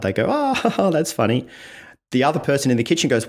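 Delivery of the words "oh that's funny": high acted burst of laughter; mock amused laugh; laughing out loud. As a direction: pretend something on your phone cracked you up. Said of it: "they laugh out loud".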